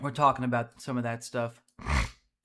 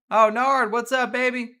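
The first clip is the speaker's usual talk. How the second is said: loud, high-pitched delighted hail; hearty greeting; 9 semitones higher, 8 dB louder than usual. Real, not acted. Streamer hailing friend Nard, hearty greeting. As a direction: greet a buddy with a big raised voice. Spots a friend messaging him.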